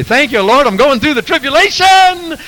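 high, loud joyful cry; preacher mimicking himself praising at camp meeting, high and loud; mock rejoicing. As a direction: shout thanks like a camp-meeting crowd. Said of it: "I get up and rejoice with them and say".

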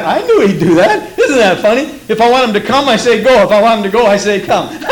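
loud, laughing indulgent boast; amused parent. Acted. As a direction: chuckle proudly at your disobedient kid.

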